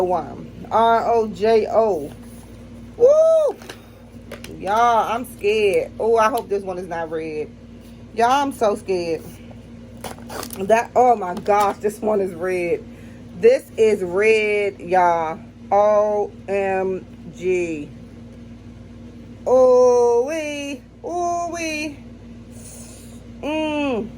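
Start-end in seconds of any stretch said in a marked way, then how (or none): 3.0-3.6 high, loud whoop at the burn; real spicy whoop; heat whoop. Real, not acted. Bracing for spicy chip. 19.5-22.0 ooh-wee. Real, not acted. Tasting hot chip.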